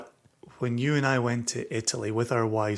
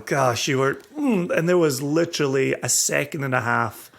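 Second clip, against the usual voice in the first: usual speaking voice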